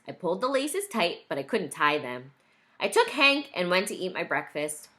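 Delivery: ordinary delivery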